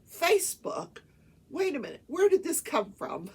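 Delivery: high, loud disbelief; retold incredulous judge. Judge hearing of Facebook screenshots, mock incredulous. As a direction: imitate a judge taken aback and demanding answers.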